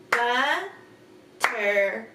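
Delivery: "Butterfly" in three slow, separated beats; split into syllables. Shown as a demonstration. Teacher repeating the clapped word, syllable by syllable. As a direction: pause after "but" and after "ter" in "Butterfly". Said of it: "Let's try it again".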